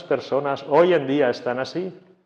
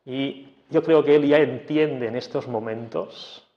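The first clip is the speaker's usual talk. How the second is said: ordinary delivery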